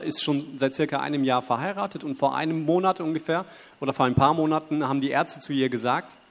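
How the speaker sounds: ordinary talk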